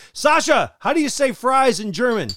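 loud, high mock Sasha voice; Sasha impression. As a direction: call out like a brewer friend. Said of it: "terrible impersonation of Sasha Tebow".

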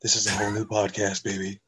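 low, smooth late-night DJ; podcast DJ voice. Acted. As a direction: croon it deep, hammed-up radio style. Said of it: "talking like this for the podcast".

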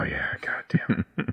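whispered under breath. Real, not acted. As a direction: mutter it unvoiced. Muttered exasperation.

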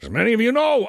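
high, drawn-out put-on accent; mock letter-reading in a high caricature accent; Troy Newman, generic accent. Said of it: "he doesn't exactly talk like that".

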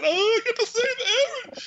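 badly faked tearful cry; mock crying. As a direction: blubber it in a hammed-up high voice. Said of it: "so he was all crying".

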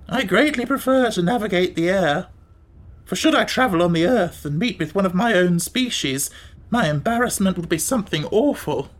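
put-on, lilting Gump voice; haughty Gump. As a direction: play a self-conscious beast with swooping pitch.

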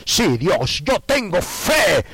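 high loud boastful cry, slowed down; 1 mock confident shout. A believer boasting faith to God, loud and high. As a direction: shout your faith like a showoff.

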